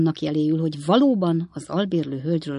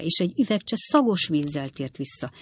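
ordinary delivery

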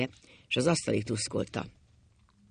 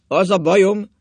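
very loud, higher, decisive declaration; firm, resolute voice; 6 semitones higher, 12 dB louder than usual. A narrator's reading. Drunk husband restarting his confession, loud and determined. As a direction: hammer it out with full determination. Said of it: "mondta mély, határozott hangon".

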